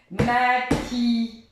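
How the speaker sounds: maquillage said very slowly, syllable by syllable. Shown as a demonstration. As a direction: stretch each syllable for the child. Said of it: "pour faire".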